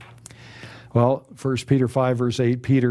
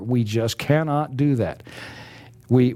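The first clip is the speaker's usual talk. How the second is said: ordinary talk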